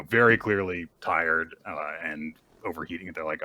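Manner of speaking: ordinary talk, nothing marked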